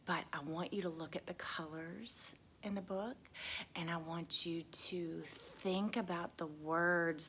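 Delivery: hushed whisper to the class. Real, not acted. Teacher whispering to the class. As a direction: lean in and whisper softly.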